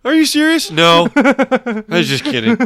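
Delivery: high, loud, helpless laughing voice; laughing re-enactment. Retold or imitated. Friend replaying the fart exchange to co-host, cracking up. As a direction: squeal it through laughter.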